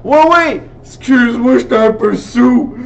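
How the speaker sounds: put-on loud, slurry drunk guy voice; tipsy manager voice. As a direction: play a tipsy bar manager. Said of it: "Il fait comme". (voix de gars un peu soûl)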